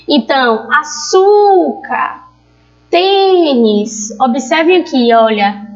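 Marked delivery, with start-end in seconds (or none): 0.7-2.3 açúcar with a high, long ÇÚ; calling out the word. Shown as a demonstration. Teacher showing paroxítonas, calling açúcar with a long high ÇÚ. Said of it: "a sílaba tônica vai ser a penúltima". 2.9-4.1 tênis with TÊ held long; calling out the word. Shown as a demonstration. Teacher showing paroxítonas, calling tênis with a long TÊ. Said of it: "a sílaba tônica vai ser a penúltima".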